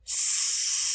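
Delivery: hissed S sound, no voice. Shown as a demonstration. Teacher hisses S for the class. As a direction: say S as a hiss. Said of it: "So listen".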